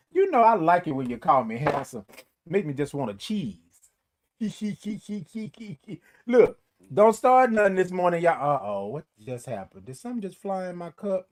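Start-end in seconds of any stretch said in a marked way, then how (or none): 4.4-5.9 soft string of hee-hee giggles. Real, not acted. Host called handsome by viewer, soft giggling. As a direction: giggle softly in quick little beats.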